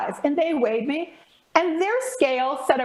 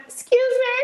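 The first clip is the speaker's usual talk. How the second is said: high, loud, outraged "Excuse me"; indignant excuse me; 11 semitones higher, 5 dB louder than usual. Retold or imitated. Retelling the weigh-in to the hosts, high loud indignant protest. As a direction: blast it out, way up high, affronted. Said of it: "I found my voice".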